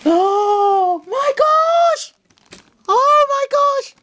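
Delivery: very high, drawn-out shriek of delight; astonished squeal. Real, not acted. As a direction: squeal slowly in sheer amazement. Amazed by the gifts.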